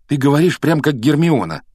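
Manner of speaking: loud, high-pitched rebuff; cutting retort. Acted. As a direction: cut the friend off irritably. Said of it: "отрезал Гарри".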